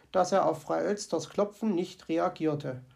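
ordinary delivery, nothing marked

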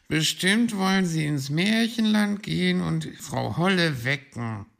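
loud, high whiny grumble; disgruntled high character voice; grumbling. Acted. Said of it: "murrte Borstel".

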